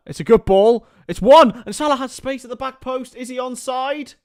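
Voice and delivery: high, loud rising call; sudden hope at the back post; excited commentary. Real, not acted. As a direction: call the chance at full pitch. Ball into the box, chance.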